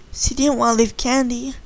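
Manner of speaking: ordinary delivery, nothing marked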